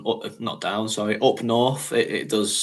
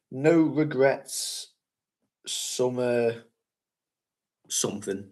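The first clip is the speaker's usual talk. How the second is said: slow deciphering read; 3 dB quieter, slower than usual. Shown as a demonstration. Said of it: "I think it says".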